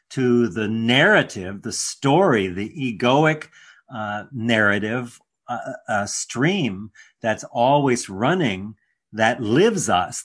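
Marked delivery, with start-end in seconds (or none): none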